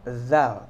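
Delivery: "zal" as voiced th; slow dental "zal"; bitten-tongue Z. Shown as a demonstration. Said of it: "seperti huruf Z tapi lidahnya digigit".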